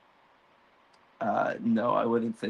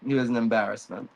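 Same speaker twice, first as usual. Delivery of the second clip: ordinary talk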